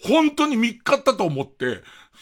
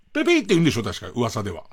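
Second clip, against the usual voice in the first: ordinary talk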